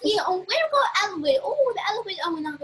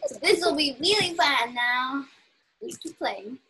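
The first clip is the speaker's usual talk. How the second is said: usual speaking voice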